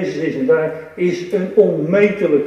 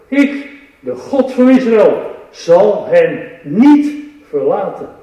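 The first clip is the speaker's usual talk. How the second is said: scripture reading; 2 semitones higher, 5 dB louder than usual. Acted. Reads Isaiah with force.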